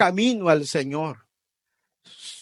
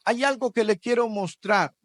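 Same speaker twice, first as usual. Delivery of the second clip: ordinary talk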